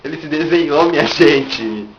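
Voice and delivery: slow, loud mock awe; mock amazed exclamation. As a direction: drag it out, astonished and loud. Mock-amazed at wall drawings.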